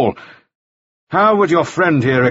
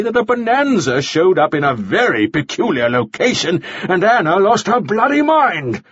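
higher-pitched, heated accusation; angry rant; 5 semitones higher than usual. A narrator's reading. Mannering rages at Charlie Frost, angry rant. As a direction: build the case in exasperation.

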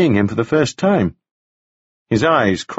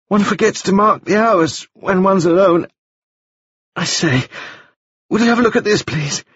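put-on much higher, bright boyish voice; high bright young gentleman; 9 semitones higher than usual. A narrator's reading. Wounded Staines greeting Taufari, high and bright. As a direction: lift it up high and bright like a dazed young toff. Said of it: "speaking in a voice that was high and very bright".

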